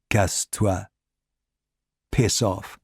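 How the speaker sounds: low, quiet dismissal; Ricard's voice. A narrator's reading. Ricard dismissing the Gestapo man, low and quiet. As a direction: say it low and final.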